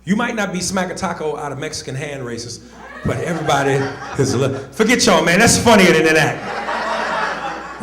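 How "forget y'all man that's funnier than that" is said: raised, louder scolding; playful annoyance. Real, not acted. Comedian to audience too scared to laugh, loud playful annoyance. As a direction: chide the crowd at full voice.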